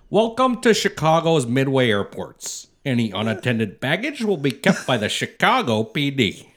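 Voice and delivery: put-on booming old-school airport announcement; airport PA announcer. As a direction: announce it like the old Midway PA guy. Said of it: "And he'd go".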